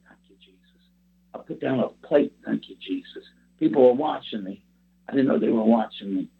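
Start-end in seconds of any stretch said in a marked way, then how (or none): 0.1-0.8 barely audible whispered prayer; hushed, under-the-breath gratitude; whispered thanks. Retold or imitated. Private thanks while waiting tables. 2.4-3.2 low whispered prayer; quiet little aside of gratitude; whispered thanks. Retold or imitated. Private thanks while waiting tables.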